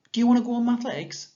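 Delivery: put-on high, fast, lively child's question; voicing Esther. Shown as a demonstration. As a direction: read it as an eager big sister asking.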